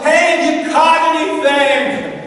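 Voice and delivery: high, loud call across water; yelling. Retold or imitated. Man on the beach hailing fishermen, yelling. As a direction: call out to the distant boat. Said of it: "he yells".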